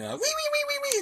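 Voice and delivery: high squealing wee-wee siren noise; siren imitation. Imitating the radio's alert for rescuers, high siren squeal. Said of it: "alert sound".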